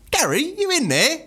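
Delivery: high slow call through the door; stepdad calling. Retold or imitated. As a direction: lightly mimic the cheery stepdad calling in.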